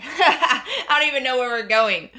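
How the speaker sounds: amused, laughter breaking into the words; laughing. Real, not acted. Fan giggling over her own eager offer, laughing.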